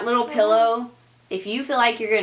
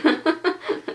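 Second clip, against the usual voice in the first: high giggle; 9 semitones higher than usual. Real, not acted. Laughing at her own story.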